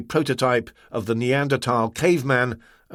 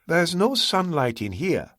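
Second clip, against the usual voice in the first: usual speaking voice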